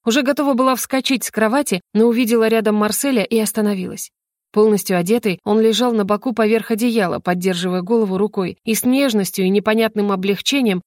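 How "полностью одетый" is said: ordinary delivery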